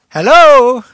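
very loud, high-pitched hello; big hello thrown to the whole crowd; booming greeting. Real, not acted. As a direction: call out for a bigger response. Repeats greeting louder to audience.

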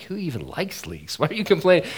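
rising into a disbelieving cry; mock outrage at the whiners; incredulous outburst. Preacher to congregation, rising into incredulous mock outrage. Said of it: "I'm like".

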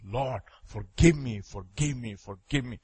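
low, soft, slow mock-penitent plea; subdued retelling of a tearful prayer; imitated weeping plea. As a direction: plead quietly, without real tears. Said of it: "started weeping and crying and wailing and saying".

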